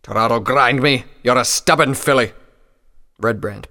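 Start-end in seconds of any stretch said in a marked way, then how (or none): 0.0-2.3 loud, grumpy outburst; exasperated. Acted. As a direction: scold a stubborn youngster. Exasperated old pony scolding filly.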